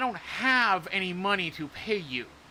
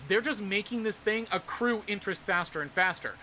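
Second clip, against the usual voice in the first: ordinary talk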